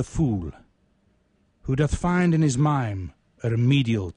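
slow, measured poetry reading with long pauses; poem recital. Acted. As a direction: let each line land slowly, like a eulogy. Reads his poem aloud.

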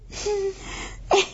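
giggling laugh. A narrator's reading. Laugh alone on line.

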